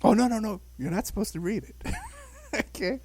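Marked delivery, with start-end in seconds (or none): 0.0-1.8 high, dismissive mom voice; mock mother. Mother waving off the child, high dismissive. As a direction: wave the idea away. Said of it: "And she says".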